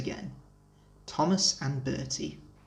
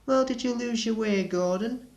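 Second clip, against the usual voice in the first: mocking; 8 semitones higher than usual. A narrator's reading. James mocking Gordon.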